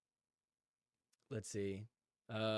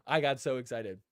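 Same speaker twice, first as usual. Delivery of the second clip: normal talking voice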